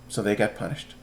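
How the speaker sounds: ordinary delivery, nothing marked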